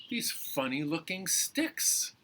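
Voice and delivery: usual speaking voice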